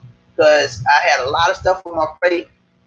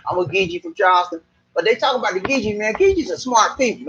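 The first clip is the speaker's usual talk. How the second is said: usual speaking voice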